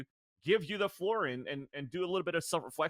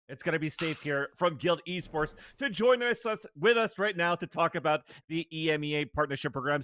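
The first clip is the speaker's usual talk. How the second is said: louder energetic show-host welcome; host intro; 6 dB louder than usual. Acted. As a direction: pump up the return from the break.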